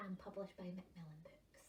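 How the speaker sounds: soft whispered aside; hushed whisper. Acted. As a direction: drop to a whisper for the credits. Reading credits to children.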